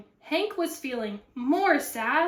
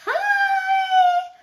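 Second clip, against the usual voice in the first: very high, squeaky loud greeting; lizard voice; 7 dB louder than usual. Acted. Lizard greeting the cactus, high and squeaky.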